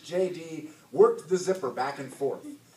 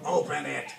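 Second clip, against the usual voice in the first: snarling. A narrator's reading. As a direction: snarl the short command. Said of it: "He pointed at the backpack and snarled".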